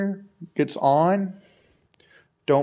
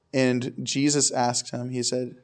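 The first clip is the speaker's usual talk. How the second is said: ordinary talk, nothing marked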